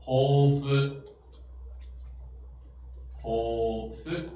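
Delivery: "ho fu" with the ho held long; long vowel. Shown as a demonstration. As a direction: stretch the "ho" in "ho fu" before a short fu. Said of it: "长音的". ("ho fu"的ho拉成长音)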